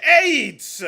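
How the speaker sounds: yelled punchline; sudden shout. Acted. Comic answering a stranger's question with a yelled punchline. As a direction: blurt it out, loud and high.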